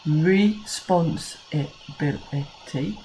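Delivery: "Responsibility" said very slowly, syllable by syllable; a teacher counting syllables for children, slowly. Shown as a demonstration. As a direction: beat out each syllable of "Responsibility". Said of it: "all have six syllables".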